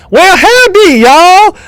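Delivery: put-on southern-accented jester hollering a welcome. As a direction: put on a high-pitched southern drawl. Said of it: "he has a southern accent".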